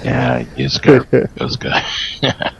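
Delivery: slow, raspy, half-whispered Yuska; hoarse sick voice. Real, not acted. Sick caller confirms his name to the host, hoarse and slow. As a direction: drag the name out through a sore throat. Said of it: "You sound terrible".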